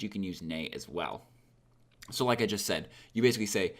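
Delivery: ordinary talk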